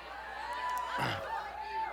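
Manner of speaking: exaggerated high fake cough-choke; hammed-up choke on the words; mock throat clearing. Fake choking on rival team.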